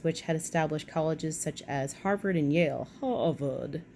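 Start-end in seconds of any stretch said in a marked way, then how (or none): 3.0-3.8 British accent. Shown as a demonstration. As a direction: say Harvard like a posh Brit, ah-vad. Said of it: "you've gotta say that with a British accent".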